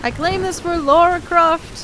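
mock heroic proclamation. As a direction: plant the flag for Lara Croft, hammed-up. Mock heroic claim for Lara.

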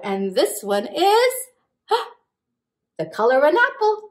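usual speaking voice

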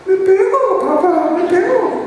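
put-on high, tearful child's whine; a little boy on the verge of crying; near tears. Small son almost crying to his father, high and tearful. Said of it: "casi ya llorando me dice".

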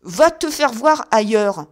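loud, slow, shouted brush-off; rough dismissal. A narrator's reading. Old man sending the visitor away, slow loud shout. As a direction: hurl it down from the window. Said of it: "répondit la voix".

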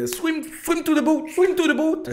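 shrieking high and loud in English; panicked yell. Retold or imitated. Yelling to swimmers about a shark, shrieking panic. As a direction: scream at everyone to get to the boat. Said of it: "Tout ça en anglais".

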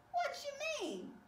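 high-pitched cartoon protest; indignant shark. Shark defends his lemonade, high-pitched protest.

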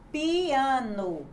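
"Piano" drawn out, each sound audible; very slowly. Shown as a demonstration. Teacher showing how to read a word, very slowly. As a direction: stretch "Piano" evenly as pi-a-no. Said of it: "Leia bem devagar a palavra piano".

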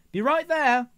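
loud, high-pitched call; calling back. A narrator's reading. Velvet answers Fox while running off, calling back loudly. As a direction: sing out a reply to a teammate.

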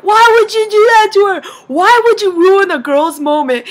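high, loud mock scolding; heated protest, acting out a brother; imitated outraged brother. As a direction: scold him in exasperated disbelief. Brother scolds dad, acted out.

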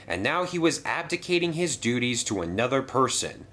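usual speaking voice

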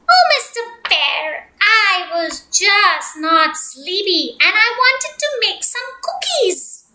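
high, singsong little duck; sweet duck voice. Acted. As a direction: coax him in a high sweet voice.

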